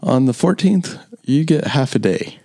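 high-pitched, hyped-up boss announcing to staff; mock-cheery announcement. Mock-announces meagre holiday perk to staff.

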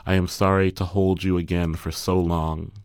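normal talking voice

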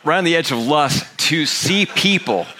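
rally the room, peaking on the final question; rousing preaching. Real, not acted. Preacher asking the congregation if they are with him, rousing.

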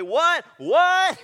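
high, loud questioning; shouted what. Acted. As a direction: call it out over crowd noise, building. Straining to hear in a crowd.

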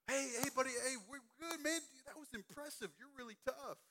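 high, easy encouraging tone; reassuring buddy. Retold or imitated. Counselor calming a camper who fell, lightly reassuring. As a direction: cheer the kid up lightly. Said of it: "we were acting like".

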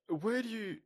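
high, laughing question; real amused laugh; laughing disbelief. Real, not acted. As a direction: ask through a laugh. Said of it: "laughing disbelief".

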